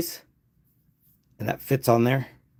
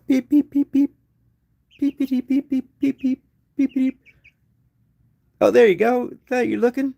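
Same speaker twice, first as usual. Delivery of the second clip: calling a chick; 12 semitones higher, 4 dB louder than usual. Real, not acted.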